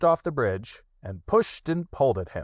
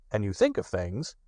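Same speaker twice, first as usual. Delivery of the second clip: ordinary delivery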